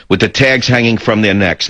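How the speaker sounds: usual speaking voice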